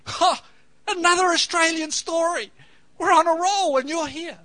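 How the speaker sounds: high, loud burst of delighted surprise; excited outburst. Real, not acted. Preacher to congregation, delighted at another Australian story, loud and high. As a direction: blurt it out, thrilled at the coincidence.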